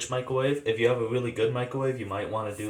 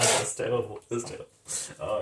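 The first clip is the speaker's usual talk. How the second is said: normal talking voice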